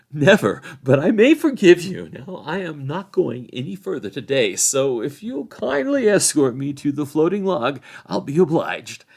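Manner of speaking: high, loud, lofty rebuff; dismissive Frodo. A narrator's reading. Frodo refusing the sheriffs' orders, dismissive and loud. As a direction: brush the officials off grandly.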